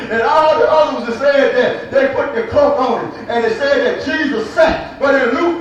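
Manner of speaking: ordinary talk, nothing marked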